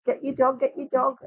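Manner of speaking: high panicked squeal, lightly mocking; high, flapping mock panic. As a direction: imitate a flustered woman calling for her dog. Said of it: "She's like".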